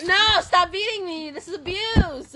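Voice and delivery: loud, high hammed-up protest; mock protest. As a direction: overplay the victim. Being beaten with blanket.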